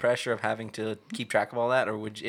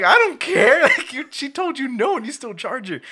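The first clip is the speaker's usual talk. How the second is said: high, loud retold shout at the clerk; retold angry outburst; 13 semitones higher, 6 dB louder, slower than usual. Man reliving his fight with the GameStop clerk, mock yelling. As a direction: yell it at the store clerk, half laughing. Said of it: "I'm like".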